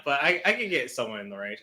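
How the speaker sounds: normal talking voice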